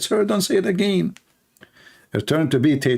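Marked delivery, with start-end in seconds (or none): 0.0-1.1 higher, urgent hushed warning; girl's warning. Retold or imitated. Office girl warning the foreigner to stop, higher and urgent. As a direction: warn him to stop. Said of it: "I said".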